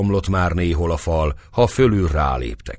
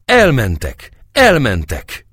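higher, louder joyful shouting; 6 semitones higher, 5 dB louder, slower than usual. A narrator's reading. Defenders cheering the Turks have gone, joyful shouting. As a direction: cheer it with growing delight. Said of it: "S egyre hangosabb örömmel".

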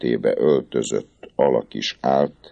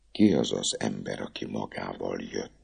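soft, hushed frightened question; asked quietly; 4 semitones lower, 8 dB quieter than usual. Acted. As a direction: drop almost to a whisper. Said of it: "kérdezte halkan a cigány leány". (halk, suttogó kérdés)

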